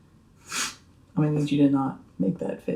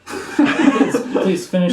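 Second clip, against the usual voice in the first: laughing; 6 semitones higher, 5 dB louder, much slower than usual. Real, not acted. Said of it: "finish chewing and swallow first".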